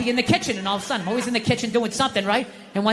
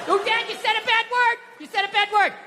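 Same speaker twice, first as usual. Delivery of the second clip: put-on high little-girl voice; daughter voice; 12 semitones higher than usual. Daughter telling dad off for a bad word, high childish voice. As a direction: tattle like a small child.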